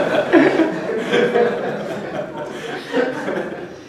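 airy unvoiced laughter; breathy laugh. Laughing at her own Beckett joke, airy laugh. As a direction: let a laugh escape. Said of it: "Brief laugh".

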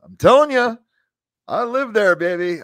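high, loud Southern twang; Southern accent. Retold or imitated. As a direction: keep up the Southern accent, insistent. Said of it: "And I can talk Southern".

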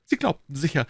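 an official scolding his subordinate with great dignity; lightly voiced self-important inspector; dignified inspector. Lestrade voiced by narrator.

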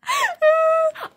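high, loud squealing laugh. Real, not acted. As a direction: shriek with laughter. Laughing at own drawings.

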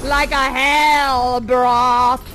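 slow, swelling theatrical incantation; witch's spell. Acted. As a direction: intone it as a witch casting over the cauldron. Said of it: "do a little spell".

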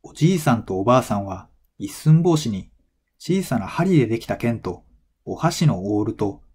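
ordinary talk

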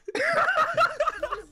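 high, loud cackling laugh; helpless high laugh; laughter. Real, not acted. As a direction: burst out laughing, high-pitched. Laughing at the replay.

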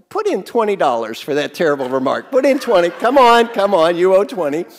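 loud playful demand; collect the fine with a grin; mock demand. Fining a participant for a terrible remark, mock stern. Teacher fines a participant jokingly.

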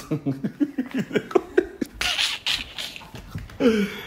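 long breathless wheezing laugh; helpless laughter. Real, not acted. Interviewer losing it over the guest's answer, real helpless laughter. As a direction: crack up and keep wheezing with laughter. Cracks up at her "now.

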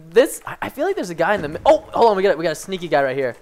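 ordinary talk, nothing marked

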